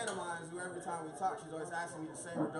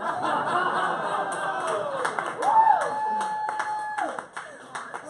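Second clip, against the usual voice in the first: long, high cackling laugh; loud cackle; 12 dB louder than usual. Real, not acted. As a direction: burst out laughing hard. Comic cracks up at own joke.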